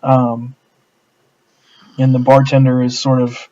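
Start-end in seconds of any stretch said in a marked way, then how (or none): none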